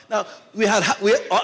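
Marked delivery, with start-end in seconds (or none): none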